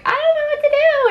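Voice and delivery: very high, hammed-up helpless squeal; helpless mock panic. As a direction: plead cluelessly in a squeaky high voice. Retold helpless panic at window button.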